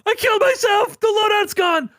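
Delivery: yelling at teammates in frustration; panicked yell. Retold or imitated. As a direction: shout it in shock. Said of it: "I'm like".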